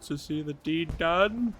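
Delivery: lightly voiced NPC drifting into an accent; put-on accent. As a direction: act the courtly NPC with an accent that slips. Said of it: "I can't hold an accent for very long".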